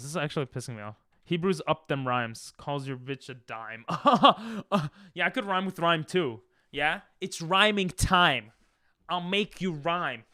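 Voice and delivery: usual speaking voice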